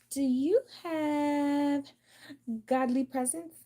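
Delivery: high, slow, drawn-out question; mock go fish asker. Playing go fish, asking the other player for a card, high and drawn out.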